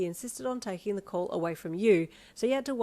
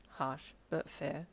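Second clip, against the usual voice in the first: dry; 3 semitones lower, 6 dB quieter, much slower than usual. A narrator's reading. Dry boyfriend reply.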